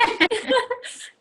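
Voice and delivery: loud high giggle; high laugh. Real, not acted. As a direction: laugh brightly.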